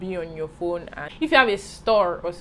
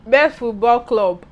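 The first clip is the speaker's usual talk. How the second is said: mock announcement; 3 semitones higher, 9 dB louder, slower than usual. Reading question with flourish.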